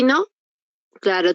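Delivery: ordinary talk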